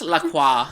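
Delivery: "La Croix" said as "la kwah"; French pronunciation. Shown as a demonstration. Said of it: "the French pronunciation is La Croix".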